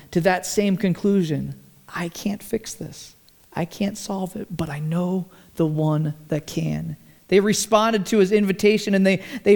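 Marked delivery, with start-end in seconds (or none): 1.9-7.0 hushed surrender. Acted. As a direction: let the words fall quietly like a prayer. Surrendered prayerful confession.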